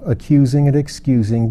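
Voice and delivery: ordinary delivery, nothing marked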